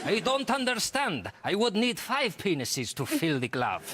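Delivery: high, puzzled mock Spanish voice; confused detective, laughing.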